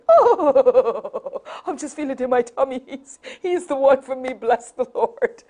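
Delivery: giddy laughing swoon. Retold or imitated. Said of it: "and you're thinking".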